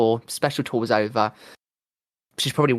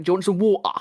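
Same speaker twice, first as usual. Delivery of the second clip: higher-pitched mock British accent; 6 semitones higher, faster than usual. Retelling the singer teasing the crowd, mock British accent. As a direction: poke fun at a British accent. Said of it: "making fun of the British accent".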